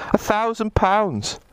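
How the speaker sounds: delighted repeat. Real, not acted.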